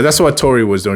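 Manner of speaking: normal talking voice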